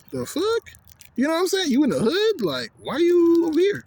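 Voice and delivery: high, incredulous squeal of surprise; shocked disbelief. Retold or imitated. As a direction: voice jumps up in disbelief. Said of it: "I was like".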